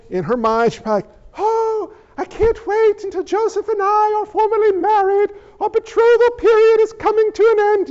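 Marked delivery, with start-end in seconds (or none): 1.4-7.9 high, hammed-up British girl; British accent. Mary daydreams of marrying Joseph, in a high British voice. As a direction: play a dreamy young bride in lofty British tones. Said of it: "Yes, she was British too".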